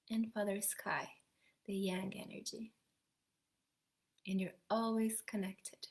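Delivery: soothing meditation guide easing the group back; guided meditation. Acted. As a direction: guide the listeners gently inward, letting phrases fade to a whisper.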